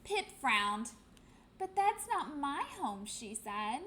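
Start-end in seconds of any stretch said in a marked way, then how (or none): 1.6-3.1 higher, small chick voice; the lost chick, a bit let down; little penguin. Acted.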